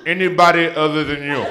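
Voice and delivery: loud mock-mom put-down; talking smack. Mom answers her own taunt to her son, loud trash talk. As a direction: land the insult loudly. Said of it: "she talks smack to me".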